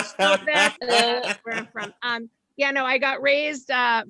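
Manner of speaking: usual speaking voice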